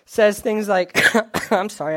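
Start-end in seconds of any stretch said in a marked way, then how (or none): 1.2-2.0 a cough, then an apology; clearing a cough before speaking; coughing fit. Real, not acted. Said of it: "I'm coughing a lot".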